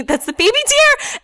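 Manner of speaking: high-pitched reveal; imitated dismay. Mom pointing out the tarp, imitated dismay.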